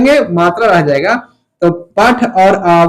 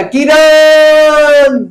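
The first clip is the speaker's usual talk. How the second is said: long, high, drawn-out call from a hilltop; long shout; 7 semitones higher, 4 dB louder, much slower than usual. Retold or imitated. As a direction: shout the name across the mountains. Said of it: "एकदम कस के चिल्लाया". (पहाड़ से लंबी ऊँची पुकार)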